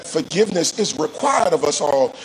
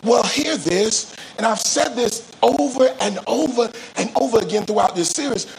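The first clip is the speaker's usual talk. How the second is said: ordinary talk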